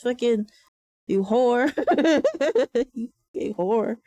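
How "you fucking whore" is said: ordinary delivery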